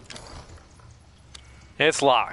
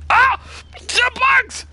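high startled scream at the bugs; frightened shriek; 5 dB louder, much slower than usual. Real, not acted. Gamer scared by bugs screaming high. Horror game jump scare.

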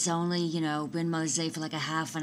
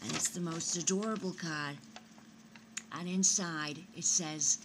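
ordinary talk

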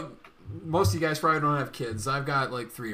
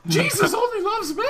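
very high squeaky pronouncement; mock Mickey Mouse mom. Friend piling onto the mom bit, high squeaky voice. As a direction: preach it as a cartoon mother. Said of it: "doing my mom's voice and a Mickey Mouse voice".

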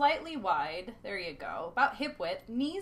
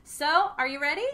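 ordinary delivery

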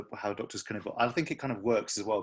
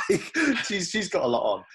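higher, louder, through laughter; laughing; 9 semitones higher, 5 dB louder than usual. Real, not acted. Host scoffing at a far-fetched casting rumour, laughing.

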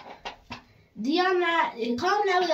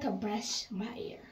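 whisper; 5 semitones lower, 12 dB quieter than usual. Real, not acted. Complaining about the brush.

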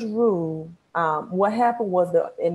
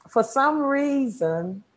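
slow, higher Mississippi drawl; Mississippi accent; 7 semitones higher, slower than usual. Shown as a demonstration. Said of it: "People like it when I say that".

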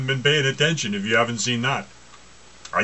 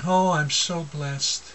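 slow, lightly voiced self-satisfied believer; mock them sweetly, slowly; smug pious voice; 4 semitones higher, slower than usual. Preacher lightly mocks blind believers claiming blessing, slow and smug. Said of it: "And yet they say".

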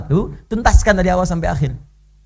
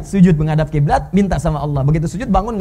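normal talking voice

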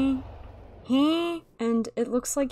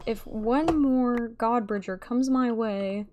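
ordinary talk, nothing marked

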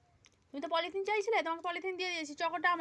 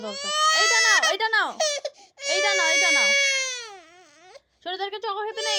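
much higher and louder pleading over crying; loud coaxing; 8 semitones higher, 9 dB louder than usual. Real, not acted. Mother loudly coaxes her crying daughter to take it.

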